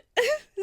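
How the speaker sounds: squealing laugh breaking up the story; high burst of laughter. Real, not acted. Laughing at her date story to a friend, a high squeal.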